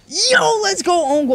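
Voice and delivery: gasp, then high loud cheer; jubilant shout. Real, not acted. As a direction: whoop in celebration. Celebrates opponent's own goal.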